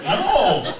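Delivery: high, loud yelp of a denial; a frantic kid's loud, high-pitched no; panicked denial. Retold or imitated. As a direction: blurt it out like a scared kid. Frantic kid denies the killing.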